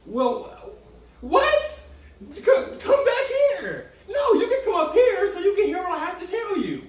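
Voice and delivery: high, loud, laughing back-and-forth yell; mock shouting argument. Dad and daughter yelling across the house, high and laughing. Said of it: "and I be like".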